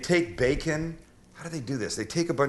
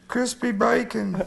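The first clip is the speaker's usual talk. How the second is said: much higher, slow, put-on English accent; hammed-up British accent, much higher; Monty Python English accent; 11 semitones higher than usual. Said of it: "that's my standard English accent".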